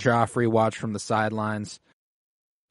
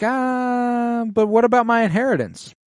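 much higher, sneering mock voice; mocking Daemon; 9 semitones higher than usual. Daemon brushing off the Vale lord, high snide mock voice. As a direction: play dumb, high and snide.

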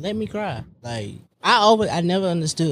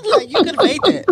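laughing tease; 11 semitones higher, 4 dB louder, faster than usual. Real, not acted. Teasing friend with laughter.

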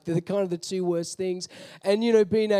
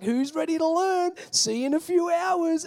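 higher upbeat send-off; cheery bus driver; 8 semitones higher than usual. Retold or imitated. Bus driver Paulie sending kids off, higher and upbeat. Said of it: "he's like".

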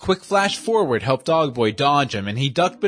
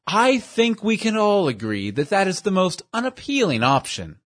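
higher, fussy, overly formal voice; pompous gentleman; 5 semitones higher than usual. Acted. Portly schemer praises his dim companion, high and prissy. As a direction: play a prissy know-it-all praising his henchman.

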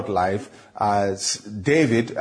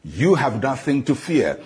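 ordinary talk, nothing marked

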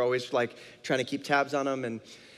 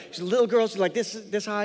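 flustered, higher and louder; retold panic; 6 semitones higher, 3 dB louder than usual. Father describing his lost daughter to strangers, mildly panicked. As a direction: imitate a worried parent hurriedly describing a child. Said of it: "all of a sudden panic kicks in".

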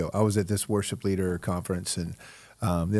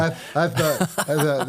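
laughing; 6 semitones higher than usual. Real, not acted. Laughing at his own story.

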